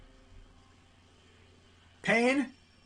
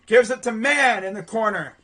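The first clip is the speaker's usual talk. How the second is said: excited call of the pass; live play-by-play; 6 dB louder than usual. Real, not acted. Commentator follows ball to the corner, excited play-by-play.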